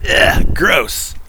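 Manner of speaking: disgusted whispered ugh; annoyed ugh. Pretend. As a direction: recoil in disgust, breathy. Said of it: "he counts all of that as".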